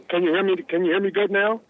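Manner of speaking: usual speaking voice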